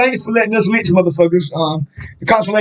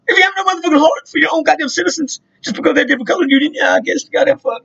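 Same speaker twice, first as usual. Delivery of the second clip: higher, fast, impassioned rant; heated argument; 9 semitones higher, faster than usual. Retold or imitated. Arguing reparations with the old man, fast and impassioned. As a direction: push the point hard and fast.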